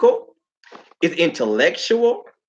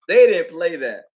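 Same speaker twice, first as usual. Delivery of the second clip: normal talking voice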